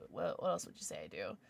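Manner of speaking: normal talking voice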